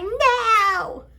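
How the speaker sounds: high, drawn-out no; retold high-pitched defiance; defiant refusal. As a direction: call your refusal through the door. Refusing the officer through door.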